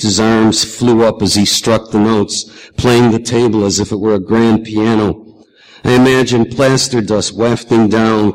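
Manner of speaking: ordinary delivery, nothing marked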